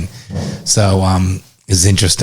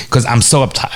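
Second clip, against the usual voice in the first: normal talking voice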